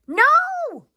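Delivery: high, loud cry of protest; a girl crying out loud to save her elephant. Acted. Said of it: "Julia cries".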